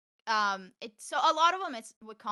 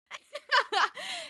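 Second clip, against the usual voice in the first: high laugh. Real, not acted. Laughing at the story.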